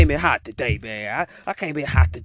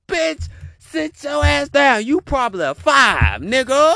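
higher, louder, slow mock outburst; retold outburst; 7 semitones higher, 4 dB louder than usual. Retelling what he'd say to the woman, mock loud outburst. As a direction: tell her off, hammed up. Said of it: "I'm like".

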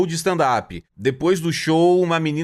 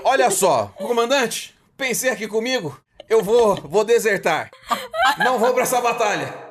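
a lightly voiced deserting soldier, higher; talking penis; 5 semitones higher than usual. Penis telling its commander it won't fight, lightly voiced. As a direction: play the penis as a soldier wanting to desert, mildly hammed. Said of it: "pro pau falar assim".

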